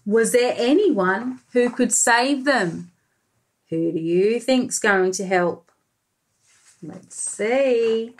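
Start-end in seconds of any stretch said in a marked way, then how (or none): none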